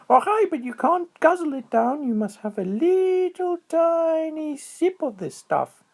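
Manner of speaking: very high, excited little squirrel voice; high squeaky critter voice. A narrator's reading. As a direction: chirp it out like a tiny squirrel. Squirrel voice held.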